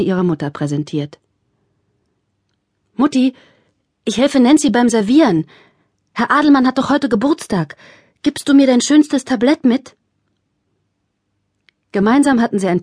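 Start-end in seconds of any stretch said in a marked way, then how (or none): 2.9-10.0 put-on eager child. A narrator's reading. Cheerful child asks mother.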